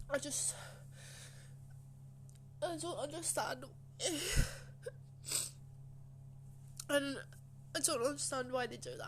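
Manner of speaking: upset, close to crying; halting, tearful and bewildered, with long pauses; really tearful. Real, not acted. Pupil recalling teachers' bullying, real tearful upset. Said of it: "it's very emotional just thinking back".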